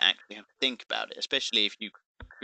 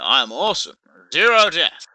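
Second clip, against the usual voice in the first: mock bragging, much higher and louder; boastful brag; 7 semitones higher, 8 dB louder than usual. A player with zero deaths bragging to the enemy, hammed-up and loud. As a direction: crow about it like a show-off. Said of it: "you know".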